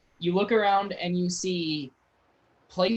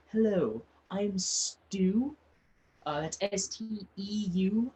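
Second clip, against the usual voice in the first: a touch higher, lightly voiced pompous official; pompous nobleman; 3 semitones higher than usual. A noble greets newcomers to his town, mildly pompous. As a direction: play a self-important town leader, lightly. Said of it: "very, very pompous, and he goes".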